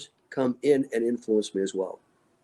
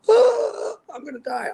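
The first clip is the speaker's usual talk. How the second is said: mock panic; 4 dB louder than usual. Mocking a panicked reaction.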